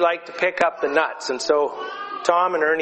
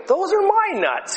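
squeaky high squirrel voice, much higher. Retold or imitated. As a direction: pitch way up like an indignant squirrel.

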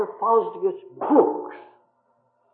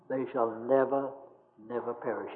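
hushed; 6 semitones lower, 10 dB quieter than usual. Acted. Verse repeated to doubters.

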